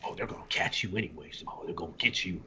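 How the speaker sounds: low, hushed ominous muttering; talking to himself. Retold or imitated. Homeless man warning nobody in particular, muttered low. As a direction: imitate a crazy loner murmuring darkly. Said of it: "he's, like, talking to himself".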